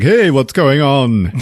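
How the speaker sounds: breezy greeting. Retold or imitated. Breezy husband greets wife next morning.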